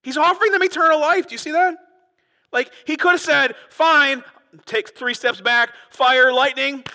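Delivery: preaching at full force. Acted. Preacher rousing his congregation, full-throated and loud. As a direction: build the outrage to the back row.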